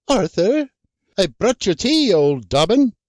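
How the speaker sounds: high, mocking housewife voice; teasing Anne. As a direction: needle him with a high scolding lilt. Anne enters the barn.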